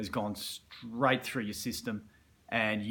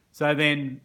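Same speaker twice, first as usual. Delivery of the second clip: usual speaking voice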